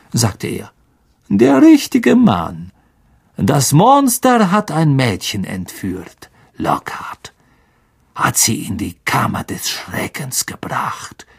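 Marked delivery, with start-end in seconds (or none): 1.3-6.3 slow, raised, sarcastic praise; mocking Snape. A narrator's reading. Snape sneering at Lockhart before the staff, slow mockery. 6.6-11.3 hissed, low Snape taunt; menacing whisper. A narrator's reading. Snape taunting Lockhart about the Chamber, menacing whisper.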